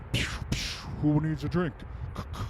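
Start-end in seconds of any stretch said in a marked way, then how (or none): 0.1-0.6 unvoiced breathy pew pew; whispered zaps. Comic softly mimics tiny ice-fist zaps. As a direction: whisper the laser noise.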